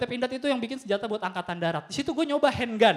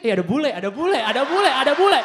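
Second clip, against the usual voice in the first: loud, laughing, thrilled chatter; giddy crowd calling out; excited gawking; 8 dB louder than usual. Acted. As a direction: point and squeal at the foreigner. Excited Indonesians spot a foreigner.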